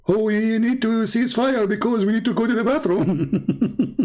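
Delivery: high, slow whining excuse breaking into laughter; mock excuse. Speaker imitates fighters excusing the ceasefire, mock pleading. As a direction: play a feeble excuse, laughing at the end.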